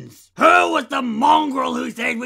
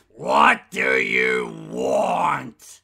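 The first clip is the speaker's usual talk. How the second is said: much lower, slow, dragged-out question falling at the end; slow gruff drawl; much slower than usual. Acted. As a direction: drag it out, fed up and bored. Grumpy voice bank.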